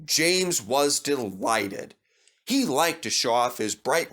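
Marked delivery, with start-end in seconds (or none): none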